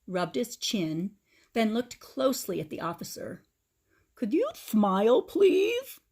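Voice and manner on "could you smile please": put-on high, slow, lisping old man voice; toothless Grandpa. A narrator's reading. Grandpa mourns his stolen teeth, high toothless lisp. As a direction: lisp through a mouth with no teeth.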